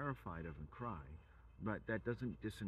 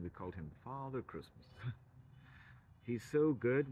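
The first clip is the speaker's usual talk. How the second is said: normal talking voice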